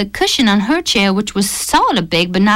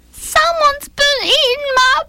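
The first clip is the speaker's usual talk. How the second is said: put-on teary Baby Bear. A narrator's reading. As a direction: whine it like a tearful cub. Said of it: "staring teary-eyed".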